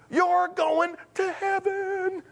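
high, very slow elated cry; drawn-out jubilation. Retold or imitated. Telling the upset woman again, slow drawn-out jubilation. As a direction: stretch HEAVEN out high, in mock elation. Repeats it, stretched out, retold.

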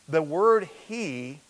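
ordinary talk, nothing marked

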